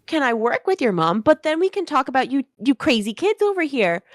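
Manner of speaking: high, sweet, gushing Southern mom; high chirpy mom voice, all charm; Honey the mom. Acted. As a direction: gush like an overfriendly realtor mother. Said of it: "And goes".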